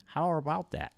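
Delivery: overdone R's on "How about that?"; R-heavy proper voice. Retold or imitated. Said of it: "She put a lot of R's on it".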